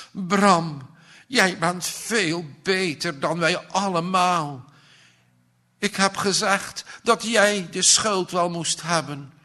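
high, earnest pleading; remorseful plea. A narrator's reading. Aunt begs orphan nephew for forgiveness.

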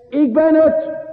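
high, ringing declaration; voicing Jesus. Preacher voicing Jesus calling to Peter, high ringing declaration. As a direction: proclaim it as Jesus himself.

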